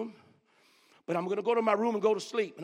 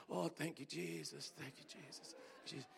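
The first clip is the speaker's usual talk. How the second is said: much softer, savoring thank you; 7 semitones lower, 16 dB quieter than usual. Retold or imitated. Preacher thanking Jesus over his tea, hushed and savoring. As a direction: murmur gratitude while sipping tea.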